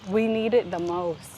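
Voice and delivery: normal talking voice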